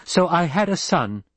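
flaring up. A narrator's reading. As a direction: snap it back, stung. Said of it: "Jessica flared".

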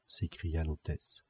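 usual speaking voice

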